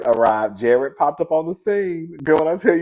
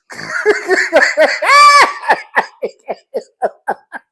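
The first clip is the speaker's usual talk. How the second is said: howling high laugh; shrieking laugh; loud burst of laughter; 7 dB louder than usual. Real, not acted. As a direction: crack up loud. Cracking up at Mary.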